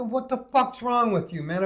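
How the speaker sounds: high, incredulous retort; retold exasperation. Patient to the nurse who offered a hand, retold exasperation. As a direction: act out an exasperated telling-off.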